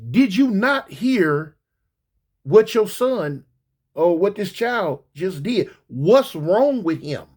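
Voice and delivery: slowed, drawn out questioning; slow pointed confronting the mother; slow disbelief. Retold or imitated. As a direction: spell it out slowly to the lady. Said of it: "lady, why you smiling".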